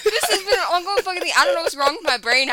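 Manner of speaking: loud laughing, words spilling out. Real, not acted. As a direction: talk through the giggles. Laughing about own brain.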